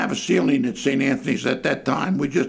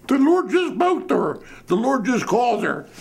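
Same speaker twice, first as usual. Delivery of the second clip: high-pitched excited old lady, hammed up; imitated panic; 9 semitones higher than usual. Panic-stricken old lady reporting to the priest, imitated high frantic voice. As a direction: blurt it high, as if awestruck and frightened. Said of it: "panic-stricken".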